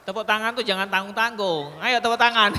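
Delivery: loud, high, laughing call to clap; rousing call for applause. Real, not acted. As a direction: whip up the crowd to applaud harder. Urging audience to applaud.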